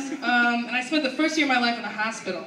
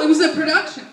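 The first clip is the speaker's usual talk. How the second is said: ordinary talk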